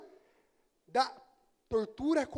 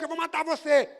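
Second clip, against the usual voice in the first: a hammed-up knife attacker's threat, louder and higher; mock threat; 5 semitones higher, 6 dB louder than usual. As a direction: shout it like a mock attacker charging. Amigo atacando com uma faca. (ameaça encenada, mais alta e mais aguda)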